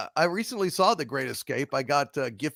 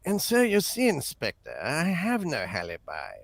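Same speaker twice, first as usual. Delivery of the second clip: unruffled English suspect under interrogation; James Mason; 4 dB quieter than usual. Acted. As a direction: deliver it as an urbane British actor. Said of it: "learned to do James Mason".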